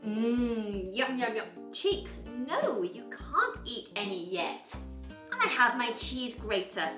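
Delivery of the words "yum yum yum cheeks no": ordinary delivery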